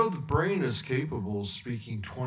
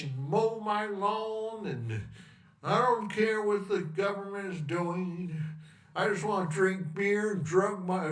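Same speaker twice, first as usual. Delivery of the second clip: put-on higher, drawled good-old-boy voice; 7 semitones higher than usual.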